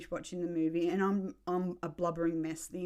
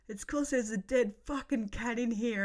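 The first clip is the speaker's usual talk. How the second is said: raised, emphatic acted exclamation; higher, mildly exclaiming; retold shocked outburst; 5 semitones higher, 4 dB louder than usual. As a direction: imitate a husband's alarmed discovery. Husband's shocked find, acted out.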